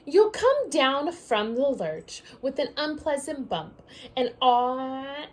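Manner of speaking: lively storybook read-aloud; reading to children. Acted. Teacher reading a picture book to her class, lively read-aloud. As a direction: read it out like story time for little ones.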